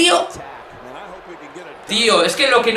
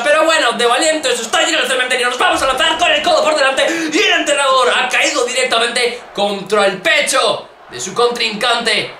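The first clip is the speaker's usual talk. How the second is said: ordinary talk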